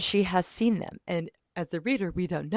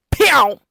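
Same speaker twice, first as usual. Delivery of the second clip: loud, high "pew"; imitated gunshot; 9 semitones higher, 16 dB louder than usual. Narrator shows him shooting the dad, loud imitated gunshot. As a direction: blast out a cartoon gun noise.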